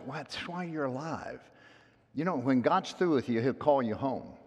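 ordinary delivery

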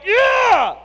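high loud cheer; fired-up yell. Retold or imitated. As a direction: cheer like a moved fan. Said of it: "you're like".

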